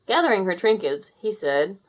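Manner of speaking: plain reading voice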